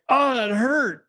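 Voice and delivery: high, squeaky pained little cry; mock hurt. To the host about his remark, a squeaky mock-hurt ouch. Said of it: "I was like".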